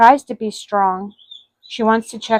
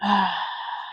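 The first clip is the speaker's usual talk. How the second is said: long soft exhale of regret; deflated sigh; heavy sigh; 9 dB quieter than usual. A narrator's reading. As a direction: let out a quiet tired sigh. Father alone driving at night.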